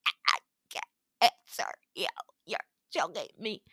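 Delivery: strained, airless words squeezed out; choked voice. Retold or imitated. Choked by the monster, she struggles to answer. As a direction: speak as if a tail is around your throat.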